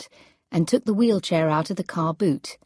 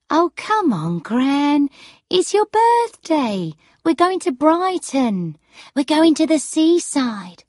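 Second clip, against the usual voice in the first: high, eager, coaxing voice; chivvying granddaughter; 8 semitones higher, 4 dB louder than usual. Acted. Granddaughter reminding gran of the Brighton trip, high and chivvying. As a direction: coax gran along, pitched well up.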